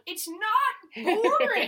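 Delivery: high, loud, slow, laughing; laughing protest. Retold or imitated. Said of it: "And I'm like".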